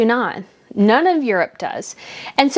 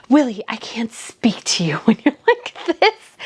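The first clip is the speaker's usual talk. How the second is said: breathy whispered quote with a laugh; mock whisper. Quoting Harry's line, mocking.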